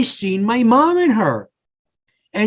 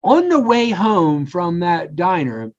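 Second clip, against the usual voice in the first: ordinary talk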